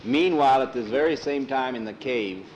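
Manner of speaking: plain reading voice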